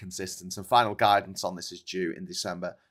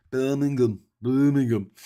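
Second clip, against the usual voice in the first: louder, higher mock Brummie; Brummie accent; 5 semitones higher, 8 dB louder than usual. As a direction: put on a Birmingham accent. Mimics the show's Brummie setting.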